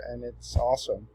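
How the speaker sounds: usual speaking voice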